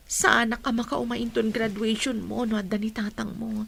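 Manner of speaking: ordinary talk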